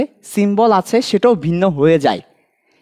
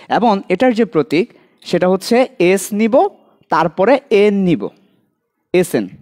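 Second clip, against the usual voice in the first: ordinary talk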